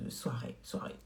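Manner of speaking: soirée, soirée whispered softly to herself. Real, not acted. Repeating to viewers it's for evenings, in a whisper. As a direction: breathe soirée, soirée without voice.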